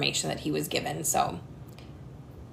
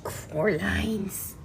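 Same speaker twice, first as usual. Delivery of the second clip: ordinary talk, nothing marked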